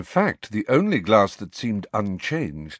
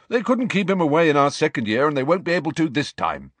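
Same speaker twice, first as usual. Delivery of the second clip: quick, higher eager schoolboy; 8 semitones higher, faster than usual. A narrator's reading. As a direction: rush it out, sure of yourself. Ernie's confident reassurance continued.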